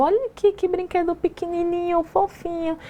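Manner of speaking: put-on high, sing-song baby talk to a toddler. Parent cooing to small child about tiny toy, high baby talk. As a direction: coo it like a parent talking down to a baby. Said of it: "essa fala infantilizada".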